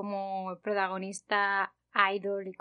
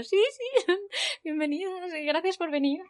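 mock cheerful reply; 8 semitones higher than usual. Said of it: "Y yo".

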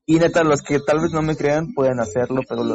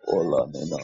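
very low, soft sultry "hola, nena"; deep fuckboy voice; 9 semitones lower, 6 dB quieter than usual. Retold or imitated. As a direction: purr it like a would-be seducer. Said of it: "era voz muy gruesa".

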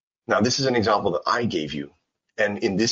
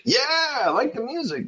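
high, excited yeah; enthusiastic reply; 14 semitones higher than usual. Acted. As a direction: jump in much higher, like a keen student. Acts out an enthusiastic student reply.

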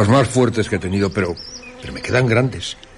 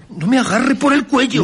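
panicked protest; 12 semitones higher than usual. Acted. Grabbed by an invisible man.

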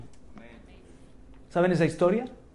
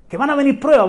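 higher, louder first call; emphatic warning; 7 semitones higher, 6 dB louder, faster than usual. Real, not acted. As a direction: ring out the warning. Preacher repeats warning phrase.

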